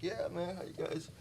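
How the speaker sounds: high, soft fake-casual greeting; playing it cool. Drunk teen on a bench pretending to be cool, high and soft. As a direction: act nonchalant up high.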